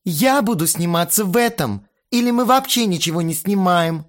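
bossy little princess. A narrator's reading. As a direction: whine like a spoiled diva. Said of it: "капризно сказала Кристина".

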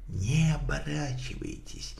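hushed whisper behind a hand; whisper through hand. Acted. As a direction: whisper it, hand covering mouth. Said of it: "прошептал Холмс, прикрывая рот рукой".

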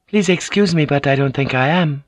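gently disagree with her husband; lightly voiced Mariko, polite refusal. A narrator's reading.

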